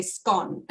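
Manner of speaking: scone said to rhyme with gone; Australian pronunciation. Shown as a demonstration. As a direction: say "scone" with a short O. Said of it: "back in Australia, we say scone".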